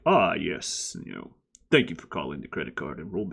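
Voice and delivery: low, fast customer service greeting; a call center script read deep and quick; phone agent voice. Retold or imitated. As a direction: rattle it off like a call center rep. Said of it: "Oh, you do it in that voice?".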